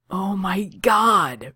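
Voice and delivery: slow, swelling amazement; breathed, delighted. A narrator's reading. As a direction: stretch it out in gleeful disbelief. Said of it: "breathed Kaner".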